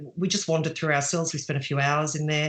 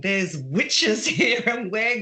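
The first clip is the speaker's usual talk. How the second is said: slow, higher, grinning and eager; a wife grinning to her husband, eager for witches; grinning excitement; 5 semitones higher, slower than usual. Retold or imitated. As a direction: relish the idea of a witch hunt with a grin. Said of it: "I had this big grin on my face".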